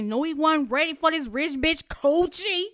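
loud, high, stank-heavy rap-girl impression; extra stank. Host mocking a rapper's lyric, extra stank. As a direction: lay the extra stank on every word. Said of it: "put all that extra stank on it".

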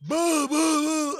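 loud, high boo boo boo gunshot noises; mock gunshots. Host mimics the shots fired at the people. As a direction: blast out pretend gunshots.

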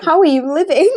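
loud, high incredulous question; mock disbelief. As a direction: chime in with laughing outrage.

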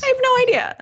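very high, amused squeal; laughing admission. Real, not acted. Laughingly admits not knowing the year.

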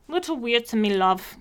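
mock British accent imitating Chloe; teasing British-accented impersonation. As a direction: put on a playful British accent. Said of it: "do it in a British accent".

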